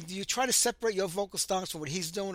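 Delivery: normal talking voice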